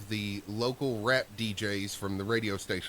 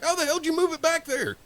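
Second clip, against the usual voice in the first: angry demand; 6 dB louder than usual. Retold or imitated. Angry coworker confronts them.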